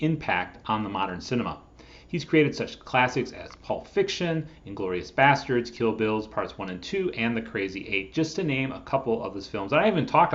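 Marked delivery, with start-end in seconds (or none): none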